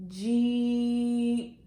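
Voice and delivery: JEEP stretched long with a separate popped P; slow, sound-by-sound Jeep for children; stretched-out word. Shown as a demonstration. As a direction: draw out the vowel of Jeep, then release a crisp P. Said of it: "Here we go".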